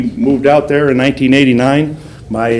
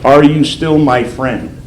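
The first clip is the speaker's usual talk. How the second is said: slow reading of the card; slower than usual. Retold or imitated. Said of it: "It would just say".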